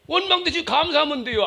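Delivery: ordinary talk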